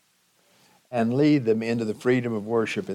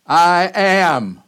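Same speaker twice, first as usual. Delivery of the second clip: loud, high, ringing "I am"; Jesus declaring himself, loudly and emphatically; emphatic; 6 semitones higher, 9 dB louder than usual. Acted. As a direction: declare it with full authority.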